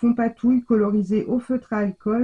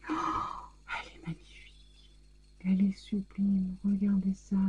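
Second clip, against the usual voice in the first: choked whisper; 10 dB quieter than usual. Real, not acted. Overwhelmed, nearly crying over gift.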